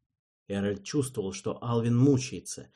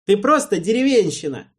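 loud, high scornful insult; contemptuous jibe; 10 semitones higher, 10 dB louder than usual. A narrator's reading. Alvin throws a contemptuous insult at Erald. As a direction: sneer it down at him. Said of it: "выдал Алвин презрительно".

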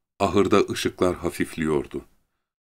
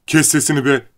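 high, loud, angry order; 8 semitones higher, 5 dB louder than usual. A narrator's reading. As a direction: silence her with an outburst. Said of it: "Bunun üzerine Lenny kızdı". (öfkeyle bağırarak)

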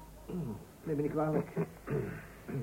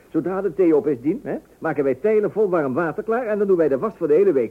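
laughs, then talks himself up, louder and keen; eager boasting; 5 semitones higher, 5 dB louder than usual. Acted. Husband bragging to his wife about washing, excited. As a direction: sell the plan with cheery bravado.

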